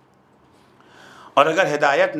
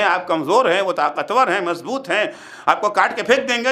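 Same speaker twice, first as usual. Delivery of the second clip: normal talking voice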